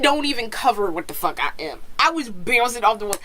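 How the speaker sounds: usual speaking voice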